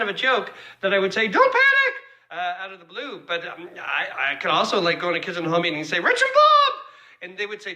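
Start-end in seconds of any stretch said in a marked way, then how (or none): 1.3-1.9 sudden high, loud yell; re-enacted meeting outburst, pitched way up; shouted catchphrase. 6.0-6.7 high, loud comic yell; mock outburst, pitched way up; shouted catchphrase. Imagined catchphrase shouted in meetings.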